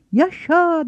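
high-pitched cheer at ordinary volume; mild mock cheer. A narrator's reading. Children hail the election plan together, high restrained cheer. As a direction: draw out a narrated hurrah, pitched high. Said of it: "diye bağırdılar hep bir ağızdan".

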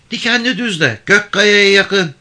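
put-on high, loud old-country mother; mother answering a stranger on horseback; village mother's voice. A narrator's reading. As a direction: call out directions in a high voice. Mother giving directions to rider.

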